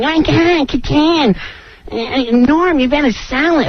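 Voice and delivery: hammed-up Katan nagging; mock Katan. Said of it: "He's like".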